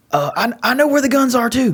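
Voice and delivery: put-on high girlish blurt; young girl voice. Daughter telling Frankie, high girlish blurt. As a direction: chirp it out like a little girl.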